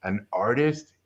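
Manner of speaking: lightly voiced Yoda, words fading at the end; a hint of a wise master, fading off; Yoda phrasing. Teacher dismissing the young artist, lightly voiced Yoda. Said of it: "again, like Yoda".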